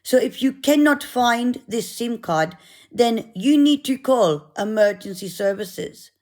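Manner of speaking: a mild attempt at a South African accent; half-hearted South African accent impression; lightly mimicked South African volunteer. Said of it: "I'll try and do the accent".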